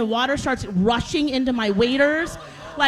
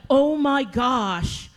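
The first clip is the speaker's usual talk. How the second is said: dragged-out dismay; much slower than usual. Retold or imitated. Retold dismay on reaching the shore.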